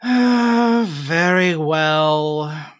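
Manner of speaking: very slow, high, sulky; reluctant. Acted. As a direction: drag it out like a bored brat. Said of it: "roll her eyes and sigh".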